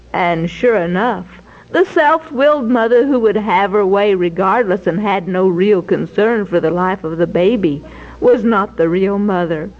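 usual speaking voice

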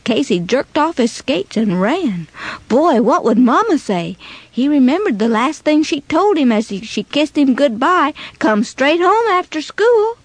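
ordinary delivery

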